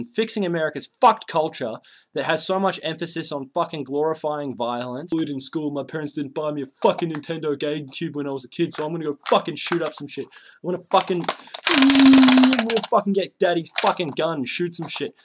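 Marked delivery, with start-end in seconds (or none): 5.7-15.1 mock spoiled shooter. Voicing a would-be school shooter.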